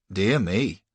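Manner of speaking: usual speaking voice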